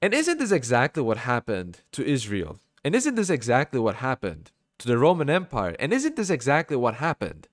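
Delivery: ordinary delivery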